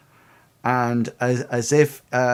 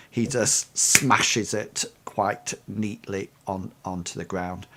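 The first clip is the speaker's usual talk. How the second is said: ordinary delivery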